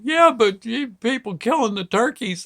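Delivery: put-on high, upset little-boy protest; imitating crying child. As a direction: protest like a small boy in tears. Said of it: "the waterworks really started".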